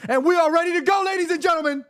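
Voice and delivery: high, ringing announcer call; showman announcement. Real, not acted. Host tells viewers the show is ready, hyped announcement. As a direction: announce it big, like a ringmaster.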